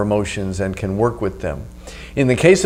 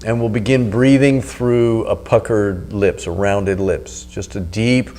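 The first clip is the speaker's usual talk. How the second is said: right in your ear; 3 semitones lower, 7 dB quieter than usual. Acted. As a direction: close and calm, guiding the breath. Leads a breathing meditation.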